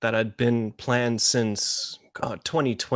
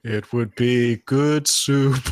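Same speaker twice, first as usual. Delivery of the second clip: slow meme delivery; 3 dB louder, much slower than usual. Pretend.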